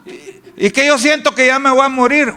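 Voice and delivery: high, fretful complaining with sighing ays; mock hypochondriac moaning about his illness; whiny worried hypochondriac. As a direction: play a worrier sure he is dying. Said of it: "le dice el doctor".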